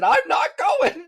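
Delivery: high, loud and slow, each word pushed; emphatic refusal. Retold or imitated. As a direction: dig in and refuse hard.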